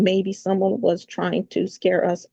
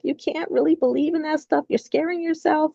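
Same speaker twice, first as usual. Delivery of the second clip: mock husband; 8 semitones higher than usual. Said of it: "He's like".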